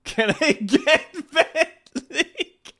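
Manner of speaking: slow, high, wheezing through laughter; laughing. Real, not acted. Streamer asking chat for the link, laughing. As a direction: ask while laughing hard.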